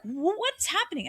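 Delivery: high alarmed cry of what is happening; retold panic. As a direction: replay the fright, pitch jumping up. Retelling panic on the bridge.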